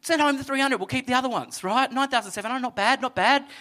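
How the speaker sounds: quick, lightly voiced mock haggling; playful fast haggle; bargaining. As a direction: talk God into a better deal. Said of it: "I'd be like".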